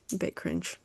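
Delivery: soft afterthought, a bit cringe; muttered under breath. Real, not acted. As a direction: drop to a murmur on cringe.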